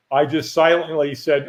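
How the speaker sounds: ordinary talk, nothing marked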